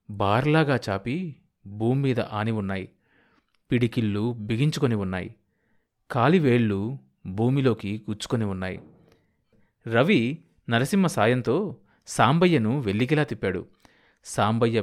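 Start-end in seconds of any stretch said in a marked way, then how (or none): none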